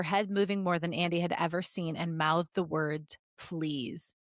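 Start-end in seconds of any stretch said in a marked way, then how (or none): none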